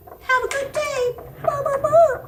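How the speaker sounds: high, loud queenly farewell; Queen Caroline voice. Acted. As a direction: sign off as the queen. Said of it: "Queen Caroline says".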